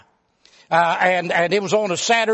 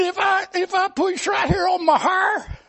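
high, tearful whine about pain; whimpering hypochondriac; 11 semitones higher than usual. Retold or imitated. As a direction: whine like every touch is agony. Arzy moaning in pain.

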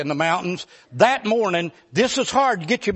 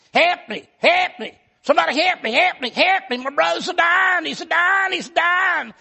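high, frantic cries for help; panicked yelling; 9 semitones higher than usual. Retold or imitated. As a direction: scream for help as if a brother's dying. Panicked cry for help in story.

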